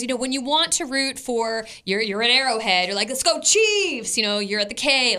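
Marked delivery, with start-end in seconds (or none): 3.1-4.2 high, slow rallying chant; fan cheer. Shown as a demonstration. Showing how fans root at Arrowhead, drawn-out cheer. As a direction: stretch it out like a stadium cheer.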